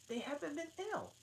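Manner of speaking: put-on high, quick girl's voice; Alice voiced. Alice guessing, high and quick. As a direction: pipe up.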